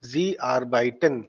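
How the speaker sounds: Sie arbeiten drawn out in clear syllables; slow model pronunciation. Shown as a demonstration. Teacher models sie arbeiten, slow and deliberate. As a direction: say Sie arbeiten slowly for learners. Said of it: "इसको ऐसे ही बोलना है".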